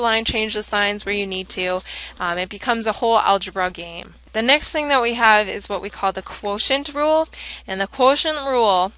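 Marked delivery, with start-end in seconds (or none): none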